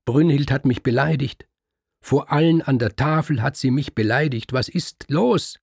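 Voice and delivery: wife to husband, hurt and furious; upset outburst. Acted. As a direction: pour out the outrage, escalating. Kriemhild runs upset to Siegfried.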